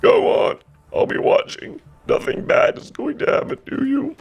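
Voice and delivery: high, breathy Kronk whisper. Acted. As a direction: hush it, high and goofy. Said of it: "good Kronk impression".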